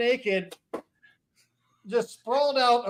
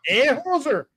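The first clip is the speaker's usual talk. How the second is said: lightly put-on Canadian hoser; a hint of a mock Canadian twang on hoser; Canadian accent; 7 semitones higher than usual. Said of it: "Scottish Canadian".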